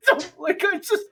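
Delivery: high, slow words through laughter; can barely get it out for laughing. Real, not acted. Telling friends about cracking Rainville, slowed by high laughter. Laughing at his own story.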